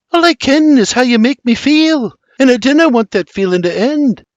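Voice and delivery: high, earnest Scottish lilt; heartfelt Scottish. A narrator's reading. Mary tells confused William her feelings, earnest Scottish lilt. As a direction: confess it urgently in high-pitched Scots.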